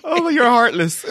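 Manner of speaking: loud, high, through laughter; laughing reproach. Real, not acted. Reacting to wife's robot remark, laughing reproach. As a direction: scold them while cracking up.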